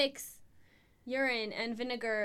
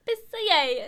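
"Pissier" higher and louder, Italian flair; Italian accent; 6 semitones higher, 8 dB louder than usual. Pretend. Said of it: "It sounds Italian".

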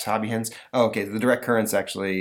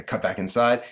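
ordinary talk, nothing marked

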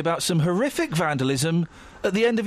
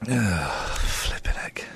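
muttered; 10 semitones lower, much slower than usual. Real, not acted. Shocked at gifts.

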